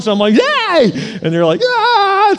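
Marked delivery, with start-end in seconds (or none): 0.3-1.2 high, over-the-top cheer; Jim Carrey. Retold or imitated. Preacher overwhelming a baby, high hammed-up cheer. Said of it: "that Jim Carrey face going on". 1.6-2.3 loud, high-pitched mock wail; imitated baby cry. Babies answering his big greeting, loud imitated wail. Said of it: "So they start crying".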